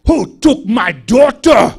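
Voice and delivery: loud, slow, furious demand; a mother bellowing for her child; outraged mama shouting; angry mother. Retold or imitated. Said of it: "This mama comes up and said".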